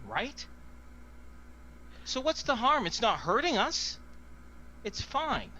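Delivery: guard clutching the necklace, pleading in panic; panicked pleading. Acted. Guard panics defending the artifact.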